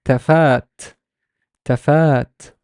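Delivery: tafat with second syllable held long; teacher drawing out the vowel in tafat. Shown as a demonstration. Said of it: "so it's a long vowel a".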